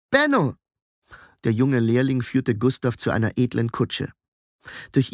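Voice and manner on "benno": put-on high, bright-spirited boyish introduction; perky young apprentice; cheery boy. A narrator's reading. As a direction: give your name eagerly, pitched high.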